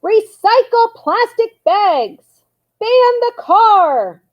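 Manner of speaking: high, loud, slow chanted yells; bellowed slogans. A narrator's reading. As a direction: bellow it at the passers-by. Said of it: "they bellowed".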